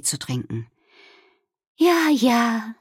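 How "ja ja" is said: higher, musing character voice; thoughtful. A narrator's reading. Wilma musing over tea with friends, thoughtful. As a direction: muse it gently to yourself. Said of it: "sagte Wilma nachdenklich".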